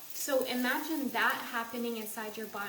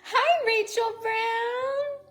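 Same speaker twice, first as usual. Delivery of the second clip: drawn-out excited greeting; 11 semitones higher, 9 dB louder, much slower than usual. Real, not acted. Greeting viewer on live stream.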